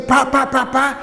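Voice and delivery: high, loud pá pá pá like a hammer; mother mimicking the constant hammering; mock hammering sounds. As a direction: knock it out like nails being driven. Mother imitates hammering all day.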